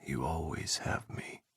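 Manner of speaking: whisper. A narrator's reading. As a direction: whisper it into his hair. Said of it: "Ethan breathed into the hair".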